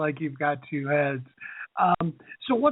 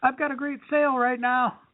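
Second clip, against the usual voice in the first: mock scream; 8 semitones higher than usual. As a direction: imitate a pushy seller yelling across a crowd. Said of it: "screaming at the top of your voice".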